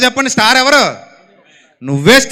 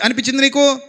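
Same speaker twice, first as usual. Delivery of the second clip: normal talking voice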